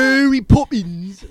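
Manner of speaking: hammed-up bad Cockney, high, loud and drawn out. Actor mocks the film's Cockney guy, exaggerated fake Cockney. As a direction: belt it slowly in a terrible mock Cockney accent. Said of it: "it's supposed to be a Cockney guy".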